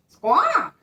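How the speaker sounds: high, loud squawk; high parrot screech. Acted. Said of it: "said a parrot".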